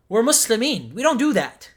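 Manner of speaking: loud, high protest; indignant. Real, not acted. Denying the host's claim, loud indignation. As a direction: push back hard, offended.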